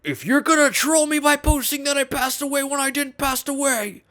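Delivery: high-pitched, hammed-up angry threat; Anthony Fantano. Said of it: "He said".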